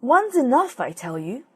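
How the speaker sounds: high, loud snap on the first words; angry magpie. Acted. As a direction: bark it at the silly dove. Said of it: "the magpie got angry".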